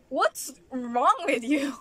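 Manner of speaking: loud, slow, drawn-out exasperated question; exasperated scolding. Real, not acted. Vlogger scolding people who insult others, loudly exasperated. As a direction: draw each word out, loudly fed up.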